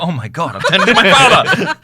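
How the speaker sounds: high, loud comic dismay; mock horror. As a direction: exclaim it way up high, appalled, half laughing. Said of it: "You go".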